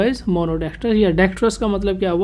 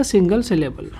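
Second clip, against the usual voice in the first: ordinary talk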